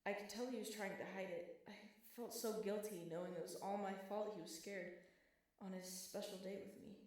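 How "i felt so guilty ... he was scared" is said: usual speaking voice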